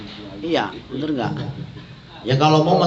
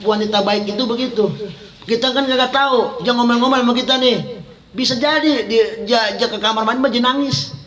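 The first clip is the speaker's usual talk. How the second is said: raised, fervent preacher voice; impassioned preaching; 7 semitones higher than usual. Acted. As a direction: preach it up high to the congregation. Ceramah dengan suara tinggi. (ceramah berapi-api dengan nada tinggi)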